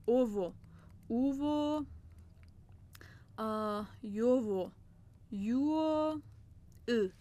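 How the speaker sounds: او and یو spoken slowly and clearly, one at a time; reciting the vowels. Shown as a demonstration. Teacher to children, slowly recites the Korean vowels.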